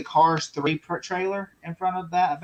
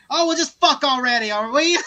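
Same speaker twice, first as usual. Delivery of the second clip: high, louder exasperated call-out; retold heckle yell; 8 semitones higher, 6 dB louder than usual. Someone yelling at Batman and Superman, raised mock-exasperated heckle. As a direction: imitate a fed-up moviegoer calling to the screen. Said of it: "someone yelled in the theater".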